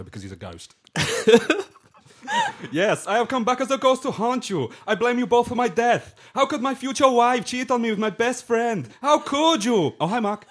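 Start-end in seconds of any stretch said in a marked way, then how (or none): 2.7-9.9 high, loud hammed-up Wiseau ghost; Johnny's Wiseau accent. [name] as the ghost of Johnny accusing Lisa and Mark, hammed up. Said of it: "practicing the accent". 10.0-10.4 high, loud mock Johnny; Johnny greeting. The ghost of Johnny greeting Mark, lightly hammed. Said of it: "practicing the accent".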